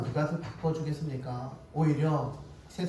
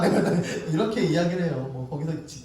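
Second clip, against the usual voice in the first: normal talking voice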